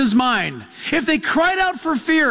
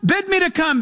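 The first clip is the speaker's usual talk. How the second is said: eager Peter; 6 semitones higher than usual. Retold or imitated. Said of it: "and he says".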